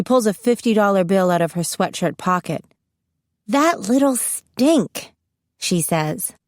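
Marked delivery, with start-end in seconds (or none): 3.5-5.1 higher and slow, affectionately scolding; fond smiling scold. A narrator's reading. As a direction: tease the absent friend fondly, drawn out.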